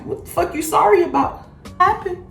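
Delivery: high-pitched baffled questioning; retold bewilderment. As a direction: ask it high, thrown and confused. Retells own startled reaction to mom.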